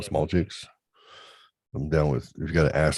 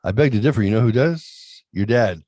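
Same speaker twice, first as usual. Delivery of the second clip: smug teasing dad; 4 semitones higher, 6 dB louder than usual. Retold or imitated. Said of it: "I said".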